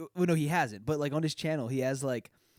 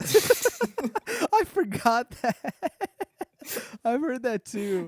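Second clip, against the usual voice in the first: laughing; 10 semitones higher, 4 dB louder, much slower than usual. Real, not acted. Cracking up at Finland joke.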